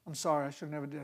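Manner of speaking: soft, low humble sorry; hushed contrite apology; quiet sheepish apology. Retold or imitated. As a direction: apologize meekly. Retold apology.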